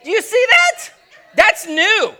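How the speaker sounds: high, loud, excited pointing out; excited distraction. Acted. Preacher acting distracted from prayer, excited shout. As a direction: get distracted and shout about the sight. Acted distraction while driving.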